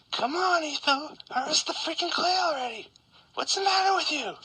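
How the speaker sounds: high, whiny mock nagging; squeaky fan complaining; impatient viewer voice. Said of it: "And you're gonna be like".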